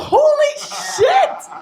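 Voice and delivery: high, slow, drawn-out shout; outraged outburst. Retold or imitated. Mother reacting to her son mocking her search engine, outraged. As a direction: stretch it into an indignant cry.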